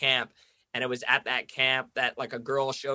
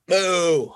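loud, higher cow moo thrown back in his face; defiant mock moo; 6 semitones higher, 9 dB louder than usual. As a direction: moo at them to taunt. Stranger mooing back defiantly.

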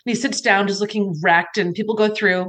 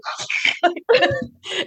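awkward laugh; 13 semitones higher than usual. Real, not acted. Laughing off the creased photo.

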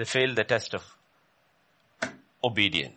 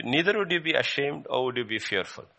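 usual speaking voice

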